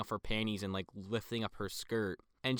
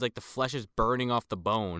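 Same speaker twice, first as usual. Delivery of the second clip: ordinary talk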